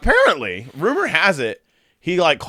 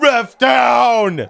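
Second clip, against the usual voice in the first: high, loud sportscaster call; hammed-up ref down call; announcer shout; 11 semitones higher, 5 dB louder than usual. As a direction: call it out like play-by-play. Calling the clip action.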